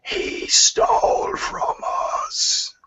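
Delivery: slow, voiceless monster rasp; drawn-out croaking accusation; rasping creature. Acted. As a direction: hiss out the accusation, word by word. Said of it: "leans forward again and says".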